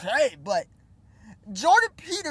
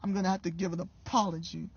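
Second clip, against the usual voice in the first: mock apology; 6 semitones lower, 10 dB quieter, faster than usual. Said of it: "That's how you should have responded".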